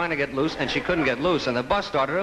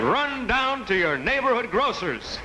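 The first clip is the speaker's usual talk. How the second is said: mock TV pitchman; 7 semitones higher, slower than usual. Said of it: "a fellow was saying".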